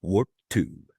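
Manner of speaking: hint of a resonant helmsman; George Takei. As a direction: lightly imitate a resonant helmsman counting off. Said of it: "great low resonant voice".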